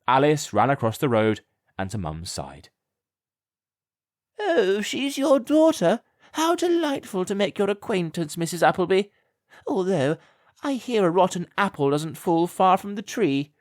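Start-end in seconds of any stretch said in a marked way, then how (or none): 4.4-13.5 honeyed insult from a grand lady; sneering lady. A narrator's reading. Lady Melantha mocks Mum with fake sweetness. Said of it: "said Lady Melantha Blagden".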